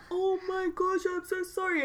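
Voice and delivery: high, soft mock apology; mortified child. Child apologises to teacher for assuming, high soft voice. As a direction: imitate an embarrassed pupil backing down.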